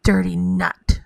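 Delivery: ordinary delivery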